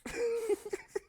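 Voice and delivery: a short high-pitched giggle of glee; high laugh. Real, not acted. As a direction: squeak out a delighted laugh. Laughs after getting in.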